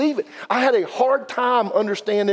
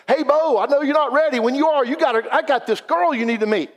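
raised, eager friend voice; excited matchmaking friend, pitched up; eager buddy pitch; 8 semitones higher than usual. Retold or imitated. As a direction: play the pushy buddy with a girl to set up. Buddy J D pushing a blind date.

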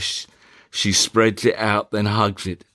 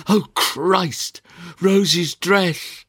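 alarmed cry; 9 semitones higher, slower than usual. Acted. Melissa finds the dress, alarmed.

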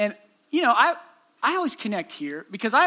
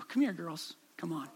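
calm call; 5 semitones lower, 11 dB quieter than usual. Retold or imitated. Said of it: "in this kinda calm voice".

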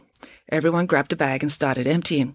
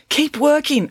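higher-pitched, pressing call; urging; 10 semitones higher, 4 dB louder, slower than usual. A narrator's reading. Friday pushes the houseboaters making rope, urging them. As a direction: spur the team on. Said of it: "urged Friday".

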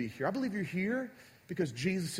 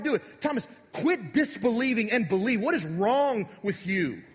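loud, higher, scolding rant at Thomas; angry Jesus; 5 semitones higher, 5 dB louder than usual. Shown as a demonstration. Pastor playing an angry Jesus scolding doubting Thomas. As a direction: berate him like a furious drill sergeant. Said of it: "reread this passage with the wrong tone".

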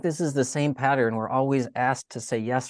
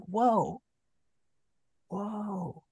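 high, soft awe, fading quieter; hushed wonder; 7 semitones higher, 6 dB quieter than usual. Retold or imitated. Mary not yet understanding the angel, in hushed wonder. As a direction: breathe it out as if quietly overwhelmed. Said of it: "full of wonder".

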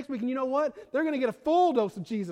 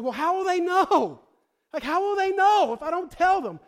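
loud, much higher, eager plea; eager question; 9 semitones higher, 7 dB louder than usual. Retold or imitated. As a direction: burst out wanting to tell everyone. New believer eager to tell family.

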